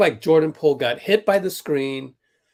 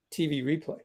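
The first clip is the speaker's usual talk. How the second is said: ordinary talk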